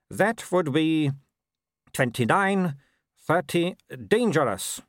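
lightly voiced young werewolf noble, cool; a hint of the arrogant son; Wulf's voice. A narrator's reading. Son answering his mother, lightly voiced young noble.

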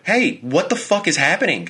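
high-pitched startled demand; retold alarm. Startled fiancé turning to his sleeping fiancee, alarmed.

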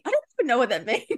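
high, rushed, cracking up; amused panic at your own bluff; laughing disbelief. Retold or imitated. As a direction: confess it quickly, collapsing into laughter. Retelling her embarrassed thought.

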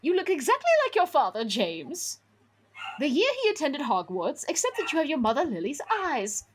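high, hurried professor voice; exasperated teacher pitched well up; McGonagall, exasperated. A narrator's reading. Professor setting Harry straight about his parents, exasperated high voice. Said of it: "in exasperation".

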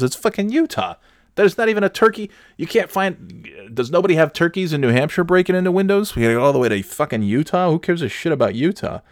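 high-pitched, indignant outburst about Utah; exasperated rant. Real, not acted. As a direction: rant in disbelief, voice way up. Ranting about a Utah story.

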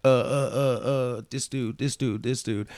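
lightly voiced stalling inmate, hemming and hawing; mocking the inmates in line. Inmates in line stalling him from the phone, mock hesitant mumble. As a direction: drag out the uhs like you're stalling for time. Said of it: "they kept on, you know, like".

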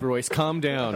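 usual speaking voice

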